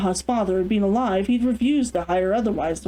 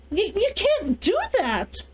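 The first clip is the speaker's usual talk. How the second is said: stammering shock; 10 semitones higher than usual. Acted. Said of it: "Kazunoha was speechless".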